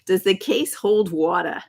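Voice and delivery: playful imitation of a New York accent. Quoting Lisa from My Cousin Vinny, imitated New York accent. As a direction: put on a movie character's New York accent.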